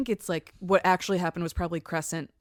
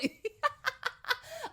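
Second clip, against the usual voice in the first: high-pitched helpless giggle; real laughter. Real, not acted. Co-host cracking up at the absurd story, high laughter.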